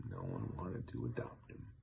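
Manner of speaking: usual speaking voice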